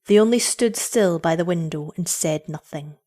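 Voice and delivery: usual speaking voice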